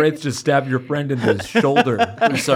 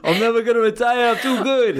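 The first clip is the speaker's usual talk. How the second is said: cocky mock-Boston quarterback; Boston accent; 5 semitones higher than usual. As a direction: brag in a higher, hammed-up Boston accent. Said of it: "Tom Brady doesn't have a Boston accent".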